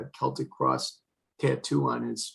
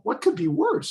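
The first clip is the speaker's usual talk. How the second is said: alarmed disbelief; 10 semitones higher than usual. Retold or imitated. Retelling how he asked his sister-in-law at the hospital, alarmed. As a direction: pitch jumps much higher in alarmed disbelief.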